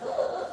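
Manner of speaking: sharp choking gasp demo. Shown as a demonstration. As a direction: show the gasping sound of a choking baby. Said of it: "they're making this gasping sound".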